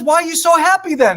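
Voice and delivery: high, puzzled mother's voice. Retold or imitated. Mom asks her son in the poop, puzzled. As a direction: lift it up like a baffled mom. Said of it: "He says".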